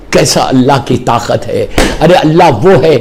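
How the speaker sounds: ordinary talk, nothing marked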